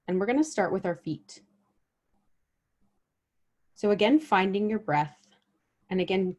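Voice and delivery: unhurried meditation guide, long pauses between phrases; guided body scan meditation. Real, not acted. Guide helping listeners notice their bodies with each breath, guided meditation. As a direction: lead them gently through the body, letting each phrase settle.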